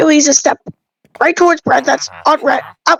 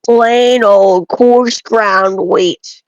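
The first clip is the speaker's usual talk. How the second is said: very slow reverent reveal; 3 semitones lower, much slower than usual. Acted. Savouring his favourite bread.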